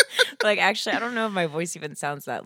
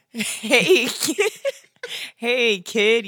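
higher, drawn-out cooing mom; 4 semitones higher, 6 dB louder, much slower than usual. Pretend. Mom voice attempt for the podcast bit. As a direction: stretch the yeah baby like a doting mom. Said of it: "a mom voice?".

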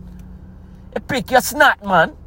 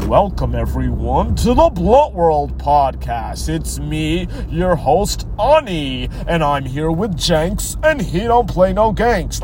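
mock too-proper podcast host; slow, mocking imitation of a proper announcer; Ani; 2 semitones lower, slower than usual. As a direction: exaggerate a host's formal show intro. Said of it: "he's too proper".

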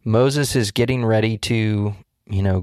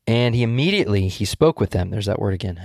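ordinary delivery

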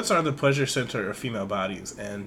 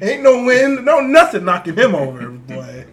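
loud, much higher, laughing excitement; laughing hype; 8 semitones higher, 13 dB louder than usual. Real, not acted. Host hypes the sturdy boy, loud laughing cheer. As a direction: cheer him on through laughter.

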